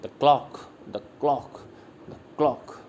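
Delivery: light "the", weighted CLOCK in "the clock"; stress on CLOCK. Shown as a demonstration. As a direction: skim over "the" and land on "clock". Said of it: "clock पे stress पड़ेगा".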